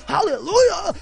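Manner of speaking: high, mock televangelist cry; hammed-up revival preacher; Pentecostal TV preacher. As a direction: imitate a preacher crying out in a commercial. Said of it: "those Pentecostal priests on T V".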